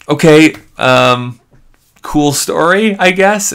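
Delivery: uneasy reply to a stranger's strange tale; awkward brush-off. Retold or imitated.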